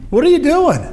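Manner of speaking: sharp, high-pitched "What are you doing?" with the pitch leaping up on "you"; a friend staring you down, demanding "What are you doing?" in disbelief; confronting question. Retold or imitated. A friend confronting someone who is in a bad way, high and incredulous. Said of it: "looked you in the face and said".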